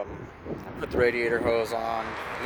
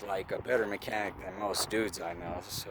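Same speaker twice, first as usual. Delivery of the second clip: ordinary talk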